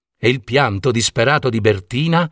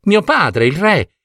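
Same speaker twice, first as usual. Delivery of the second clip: higher-pitched princess protesting the news; distressed disbelief; 7 semitones higher than usual. Acted. Princess refuses to believe the gnome. (incredula, voce più acuta)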